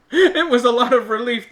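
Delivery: ordinary talk, nothing marked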